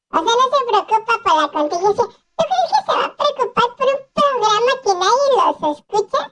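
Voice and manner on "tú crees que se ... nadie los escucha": ordinary talk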